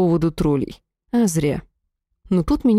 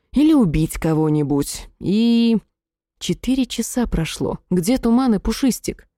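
ordinary delivery, nothing marked

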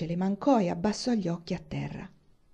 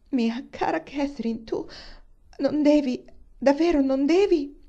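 higher, louder voice of distressed Eleanor; Eleanor pleading; 7 semitones higher, 4 dB louder than usual. A narrator's reading. Eleanor, overwhelmed, pleads with Catherine, higher and louder. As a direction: beg her friend, voice lifted high.

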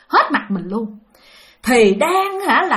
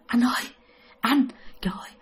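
soft, breathy whisper of fear; scared whisper; 7 dB quieter, faster than usual. Retold or imitated. Little sister whispers to her sleeping brother in fear: soft whisper. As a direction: whisper so the ghost won't hear.

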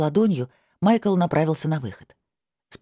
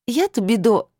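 higher, louder indignant protest; 8 semitones higher, 4 dB louder than usual. A narrator's reading. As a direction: cut off mid-protest in outrage. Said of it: "возмутилась было". (возмущённо)

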